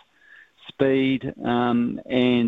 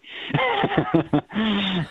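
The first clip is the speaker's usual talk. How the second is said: happy laugh; 9 semitones higher than usual. Real, not acted. Screaming at the TV, happy.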